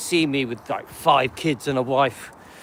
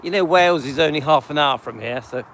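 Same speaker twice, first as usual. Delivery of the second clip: ordinary talk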